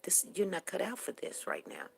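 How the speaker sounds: quiet whispered advice to a daughter; mother quietly telling her crying daughter to go home; soft motherly advice. Retold or imitated. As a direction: hush it gently, like a mother soothing. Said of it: "And I said".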